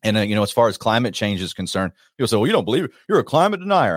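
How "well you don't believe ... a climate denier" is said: higher-pitched imitation of naysayers; mock accusation. Critics challenging the host on climate change, mock accusation.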